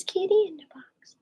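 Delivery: high, soft whispered quote; playful hushed whisper. Retold or imitated. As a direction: whisper it like a secret. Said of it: "Amanda says".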